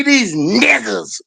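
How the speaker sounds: slow, stretched-out imitation, no hard R; with conviction. Correcting to how he really said it, slow with conviction. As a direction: draw the last word out with conviction. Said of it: "He didn't say that one".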